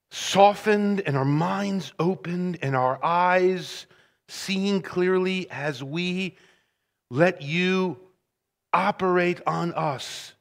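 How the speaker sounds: hushed pastoral prayer. Real, not acted. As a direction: pray it gently and quietly. Pastor prays before the text.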